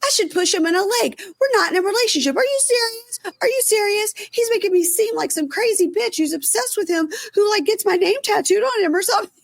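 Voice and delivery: high, whiny mock tantrum; hammed-up tearful reality-show meltdown; Stassi meltdown. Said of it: "then she pulls a Stassi".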